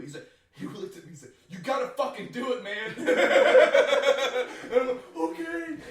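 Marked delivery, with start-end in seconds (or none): 1.5-2.9 a touch louder, laughing; half-laughing push; mock pushy yell. Buddy pressuring, quoted. 3.0-4.7 high, loud laughing burst; loud laughter. Real, not acted. 5.2-5.8 much higher, small okay; high meek okay. Retold or imitated. Retold meek agreement.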